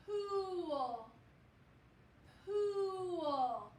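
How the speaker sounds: "Pool" drawn out on the oo, pitch sliding down; slow, sustained "Pool", twice with a pause; stretched long oo. Shown as a demonstration. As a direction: stretch the vowel in "Pool" so it can be heard. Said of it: "Can you hear it?".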